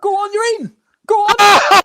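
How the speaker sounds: high, loud shout at the screen; excited shout. Real, not acted. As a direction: yell him on towards goal. Shouting as striker goes through.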